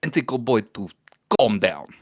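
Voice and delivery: put-on deliberate, accented character voice; measured, stern reproof in broken English; impatient Koski. Koski lifting Kellen by the cloak, scolding with strained patience.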